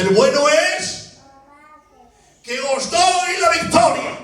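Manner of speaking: high, slowing announcement in God's role; acted proclamation. God tells Moses of the victory, acted proclamation. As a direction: proclaim the good news high, drawing it out.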